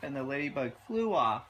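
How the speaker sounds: ordinary delivery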